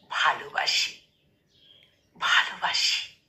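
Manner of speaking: breathy whispered confession; whispered love. Acted. Poet whispers love to his shy beloved, hushed whisper. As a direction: whisper the words close to her ear. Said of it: "দেখে বলবো".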